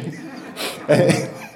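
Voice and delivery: airy rising laugh, no voice; unvoiced chuckle rising in pitch; breathy laugh. Real, not acted. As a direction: laugh out at the punchline. Laughs at own story.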